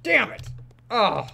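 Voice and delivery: normal talking voice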